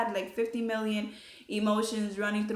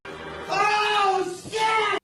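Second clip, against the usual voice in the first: shocked shout; 12 semitones higher, 7 dB louder than usual. Retold or imitated. Boyfriend shocked by test.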